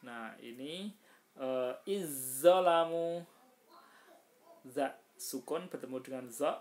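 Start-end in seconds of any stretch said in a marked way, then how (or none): 1.9-3.2 "Izzolamu" chanted slow and loud with a long doubled z; merged za into zo. Shown as a demonstration. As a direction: recite "Izzolamu" holding the heavy z, vowels drawn out. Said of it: "za-nya dihilangkan".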